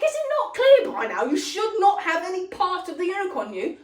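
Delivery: laughing mock rant at the character; exasperated yell. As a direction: shout at the screen in disbelief. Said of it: "I was like".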